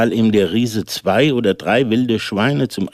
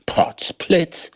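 exclaiming; 9 semitones higher than usual. A narrator's reading. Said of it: "rief der Schneider".

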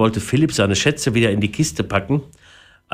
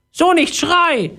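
very high, slow squawk; offended parrot; 3 dB louder, slower than usual. A narrator's reading. Parrot objects to Philipp's yelling, offended high voice. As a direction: object like an insulted bird. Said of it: "sagte der Papagei beleidigt".

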